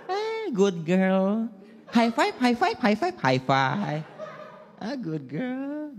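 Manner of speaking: high, gentle English-speaking rich dad; posh mall dad. Retold or imitated. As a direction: coo at the child like a rich Jakarta father. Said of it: "pakai bahasa Inggris".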